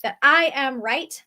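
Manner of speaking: ordinary talk, nothing marked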